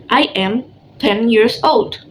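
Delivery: slow, clear teacher model; slow model reading. Shown as a demonstration. Teacher models a line for the class to repeat, slowly. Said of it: "repeat after me".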